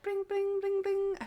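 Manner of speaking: high, soft bike bell ring; playful high-pitched bring bring; bell imitation. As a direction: chirp it out like a bicycle bell. Garbage man ringing the bell.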